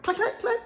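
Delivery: very high, soft clicks; small high-pitched gun noise; imitated gun clicks. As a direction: click like a little pistol. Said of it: "Little, and Leon was like".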